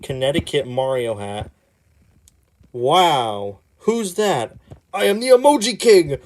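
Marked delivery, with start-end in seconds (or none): 4.9-6.2 loud, high declaration; loud, high, hammy king; grand villain boast. Acted. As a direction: announce yourself like a self-important king. Comic villain introduces himself.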